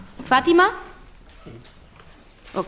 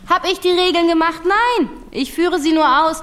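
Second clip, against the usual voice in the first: louder, higher, firm and deliberate; stern raised voice; 7 semitones higher, 4 dB louder than usual. Acted. Teacher insists on the rules to the pupil, raised and firm. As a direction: lay down the law to the class.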